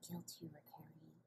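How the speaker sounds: gentle whisper. Acted. Comforting line in scene.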